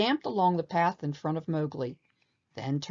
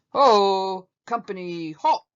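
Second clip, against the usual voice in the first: bellowing command; 3 semitones higher, 8 dB louder, slower than usual. A narrator's reading. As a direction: stop the whole troop with one bellow. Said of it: "he bellowed".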